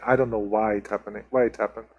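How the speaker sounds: normal talking voice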